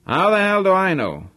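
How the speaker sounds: exasperated boss. Acted. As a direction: throw the question back at your chauffeur. Harvey snaps at his chauffeur's question.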